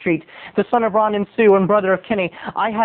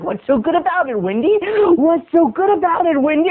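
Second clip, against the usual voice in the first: crazed, higher-pitched menace; Jack Nicholson; 9 semitones higher than usual. Acted. Sleepless boy answers mom's good morning, crazed. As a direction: snap at mom like a madman. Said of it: "just like The Shining".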